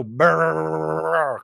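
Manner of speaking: long buzzing "brrr"; sustained imitation motor noise; rolled, held-out comic brrr; comic trilled noise. Mimes doing it constantly.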